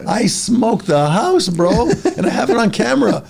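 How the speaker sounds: proud laughing brag. Real, not acted. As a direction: crow about it through laughs. Bragging about the race.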